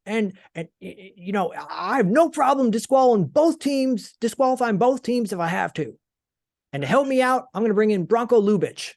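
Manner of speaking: high, emphatic telling-off of both teams; scolding referee. Retold or imitated. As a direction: imitate a referee scolding over the mic. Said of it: "He, like, scolds them".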